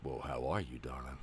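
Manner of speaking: deep, soft, silky crooner; low silky charmer voice; smooth operator voice. Retold or imitated. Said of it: "he's like".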